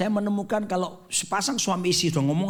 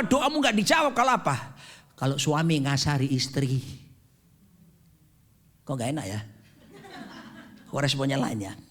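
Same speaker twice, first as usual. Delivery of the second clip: ordinary talk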